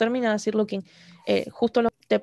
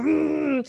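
higher annoyed "mm"; mock angry grunt; 5 semitones higher than usual. Imitating the guy getting angrier at Aang, mock angry hum. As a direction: imitate a fuming growl through closed lips. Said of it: "se enoja más y dice como".

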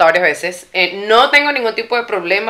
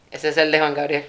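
mock Mexican accent; 7 semitones lower, 9 dB quieter than usual. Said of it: "Es como un acento mexicano, güey".

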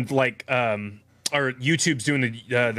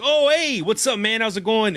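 higher-pitched, imitated Italian dad greeting; 7 semitones higher than usual. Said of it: "two weeks in, he's like".

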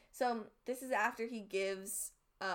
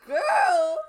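loud exclamation; 9 semitones higher, 16 dB louder than usual. Real, not acted. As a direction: blurt it out in disbelief. Reacting to a sad quote.

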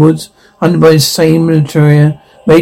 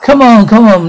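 urgent shout; 6 semitones higher, 3 dB louder than usual. Acted. Struggling in a fight.

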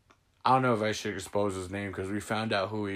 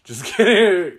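laughing just kidding; 7 semitones higher, 8 dB louder, slower than usual. Real, not acted. Teasing her family, laughing it off. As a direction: laugh it off, loud and high. Teasing after calling someone a bitch.

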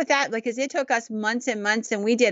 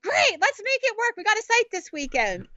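high, bright mock enthusiasm; match his excitement, pitched up; retelling her keen answer to a friend's plan; eager reply; 12 semitones higher than usual. Her own eager reply retold.

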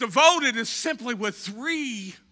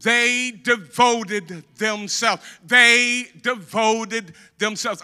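"They" punched, rest slow and falling; stress on THEY; 4 dB louder, much slower than usual. Shown as a demonstration. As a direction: hit "They" hard, then slow down. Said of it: "I want to say they devoted themselves".

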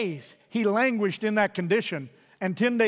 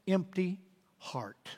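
whispered; 6 dB quieter, much slower than usual. Acted. Preacher whispering Nabal's empty heart to the congregation, hushed. Closing line dropped to a whisper.

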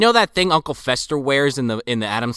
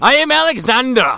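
put-on Russian accent; 8 semitones higher, 4 dB louder than usual. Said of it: "German man doing a Russian accent".

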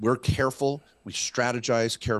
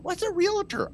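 much higher, imitating his young daughter; daughter's puzzled question. Dad imitating his daughter asking what a realtor is. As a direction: ask it like a puzzled twelve-year-old.

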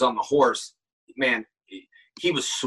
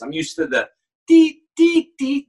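beep cue imitation; 13 semitones higher, 5 dB louder than usual. Shown as a demonstration. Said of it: "I'm used to this".

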